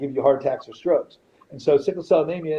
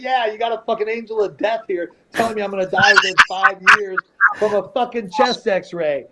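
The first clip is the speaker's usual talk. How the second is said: louder, higher, indignant re-enactment; retold angry complaint; 6 semitones higher, 3 dB louder than usual. Patient retells complaining to the doctor about the nurse, indignant. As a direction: build outrage, stretching out the final words.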